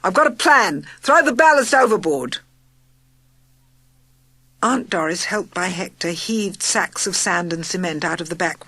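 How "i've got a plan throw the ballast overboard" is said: loud commanding call; urgent shout. A narrator's reading. Charlie shouting orders to the bus, urgent. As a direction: bark the plan out.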